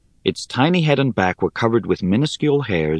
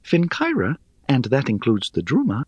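high, airy, scornful little voice; airy spider voice; 7 semitones higher than usual. Acted. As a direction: chide them, light and dry.

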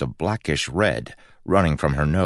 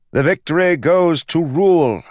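high, loud ringing announcement; loud official announcer voice; judge announcing; 13 semitones higher, 7 dB louder than usual. Acted. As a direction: call the winner out to the crowd. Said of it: "announced the judge".

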